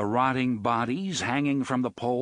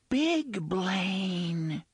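whispered; 8 semitones higher than usual. A narrator's reading. Unseen voice whispering to the travellers, high and hushed. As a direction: breathe the name like a hidden child. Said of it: "the unseen voice whispered".

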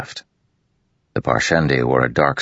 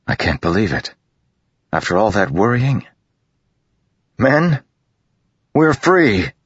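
rising higher and louder into joy; growing excitement; 8 semitones higher, 3 dB louder than usual. A narrator's reading. Kaladin telling his men they are free, rising excitement. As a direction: build from disbelief to triumph.

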